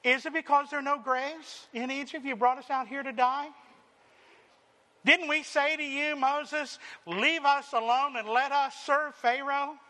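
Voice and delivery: mock the people's fearful griping; a hammed-up whine of the grumbling crowd; whiny complaint. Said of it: "they asked those stupid questions".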